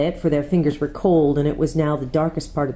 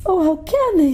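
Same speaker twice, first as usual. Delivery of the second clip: put-on much higher, anguished young girl; tearful; 14 semitones higher than usual. A narrator's reading. Lucy watching the rabble, tearful and high. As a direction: cry it out in distress. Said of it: "tears streaming down her cheeks".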